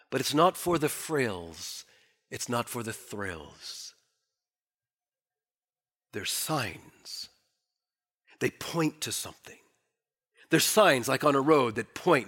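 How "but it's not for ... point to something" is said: much softer and lower; hushed emphasis. Real, not acted. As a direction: drop it to a hush to make the point. Preacher drops to quiet.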